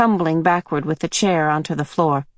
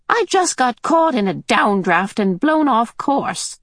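put-on high, matter-of-fact old lady; old woman voice; 6 semitones higher than usual. Mrs Watson explaining to Mrs Murray, high and breezy.

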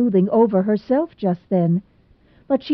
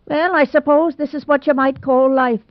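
dry, arch remark, pitched up and louder; sarcastic; 7 semitones higher, 4 dB louder than usual. Acted. Rebecca Dew to Anne, sarcastic and raised. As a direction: lay the irony on thick. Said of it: "she said sarcastically".

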